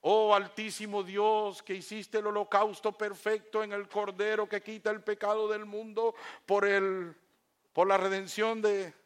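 grand drawn-out high chant-like invocation; lofty formal prayer. Shown as a demonstration. Preacher showing fancy words addressed to God, lofty prayer. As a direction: pray in pompous ornate phrases, held on one note. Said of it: "usted puede usar esas palabras".

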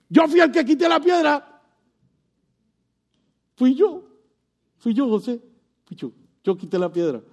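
lightly voiced, high, loud boasting angel; a hint of a proud angel claiming the deed. Angel telling believers he moved the stone, mild proud boast. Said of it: "ese ángel y diga".

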